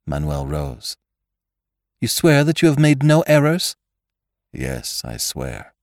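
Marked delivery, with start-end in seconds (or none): 2.0-3.8 sharp demanding woman. A narrator's reading. Pericole questions Manuel. 4.5-5.7 quiet. A narrator's reading. Manuel answers quietly.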